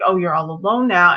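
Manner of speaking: ordinary delivery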